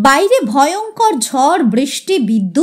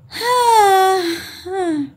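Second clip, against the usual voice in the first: sighing yes, fading out; drawn-out sigh, falling away; long sigh; 3 semitones higher, 3 dB quieter, slower than usual. Acted. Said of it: "একটা দীর্ঘ নিঃশ্বাস ফেললেন".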